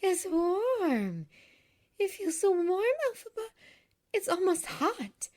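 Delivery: high, astonished gasping exclamation; gasped. A narrator's reading. Nessa feels the magic shoe warming her foot, gasped. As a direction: gasp it out, high and amazed. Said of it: "she gasped".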